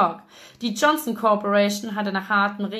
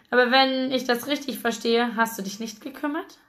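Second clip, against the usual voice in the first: ordinary delivery, nothing marked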